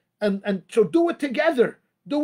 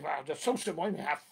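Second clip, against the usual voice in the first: a hint of a scoffing listener, much softer and lower; mock dismissive listener; 6 semitones lower, 4 dB quieter than usual. Rabbi imitating a listener who scoffs, softly dismissive. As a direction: brush the advice off as too simple, half-mocking. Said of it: "I know what you're saying".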